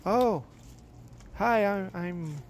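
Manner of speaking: lifted voice for a quoted hello; imitating wife's greeting. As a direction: voice her high, hesitant hello. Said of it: "she comes out of the restroom, says".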